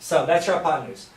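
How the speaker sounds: ordinary talk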